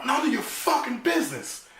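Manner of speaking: high, loud furious yell. Retold or imitated. Crying boy yelling at a mocking stranger, high and loud. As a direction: snap back with a shout. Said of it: "Then I yell".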